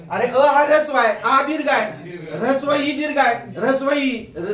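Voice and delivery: ordinary talk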